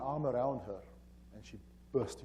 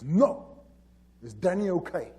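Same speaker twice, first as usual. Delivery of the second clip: loud, raised-pitch retold demand; insistent demand; 5 semitones higher, 10 dB louder, slower than usual. Wife refusing to go inside and talk, loud insistent demand. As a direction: cut off the stalling and insist on an answer.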